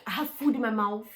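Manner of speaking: ordinary talk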